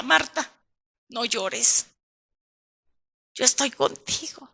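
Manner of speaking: soft, hushed comforting voice of the Lord; tender reassurance. Acted. Preacher voicing the Lord comforting Marta, soft and tender. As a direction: speak gently, as if to someone grieving. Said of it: "venga y nos diga".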